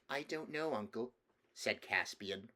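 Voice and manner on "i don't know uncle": put-on higher-pitched, unsure young nephew; little boy. Small nephew answering his uncle the king, little boy voice. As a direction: answer as a small child would.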